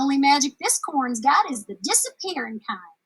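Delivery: Ma scolding her daughter. Acted. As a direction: give her a scornful scolding.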